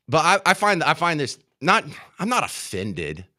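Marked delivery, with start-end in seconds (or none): none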